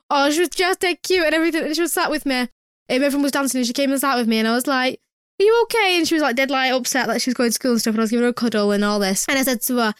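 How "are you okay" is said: gentle concern. Retold or imitated. Comforting a child.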